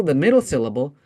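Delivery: usual speaking voice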